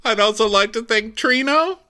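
very high, loud mock weeping speech; Candace crying. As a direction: gush tearful thanks, hammed up. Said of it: "so she's crying".

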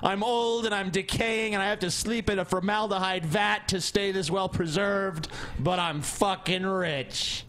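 mock rich old preacher. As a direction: gloat like a decaying tycoon.

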